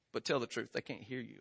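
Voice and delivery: low, very soft conspiratorial aside; confidential coaxing. Retold or imitated. Daughter asking Daddy while siblings can't hear, confidential. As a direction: coax out a secret under your breath.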